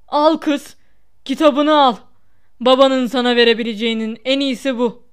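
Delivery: loud, spiteful taunt; malicious. A narrator's reading. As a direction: throw the words contemptuously at a child. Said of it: "Gözleri kötülükle ışıldayarak".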